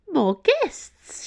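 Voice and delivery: high-pitched pleased welcome. A narrator's reading. Nun greeting new guests at the door, pleased. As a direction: greet them delightedly, pitched well up. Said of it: "looking pleased".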